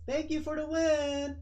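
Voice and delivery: high, loud, drawn-out call; cocky fighter. Acted. Puffed-up Kenji thanks his opponent for the win, high and crowing. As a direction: crow it out slowly to the loser.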